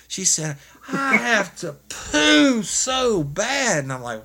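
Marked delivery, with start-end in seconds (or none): 0.9-3.8 loud, drawn-out high mock wail; screaming and crying. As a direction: imitate her desperate howl, stretching the words slowly. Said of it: "She's screaming and crying".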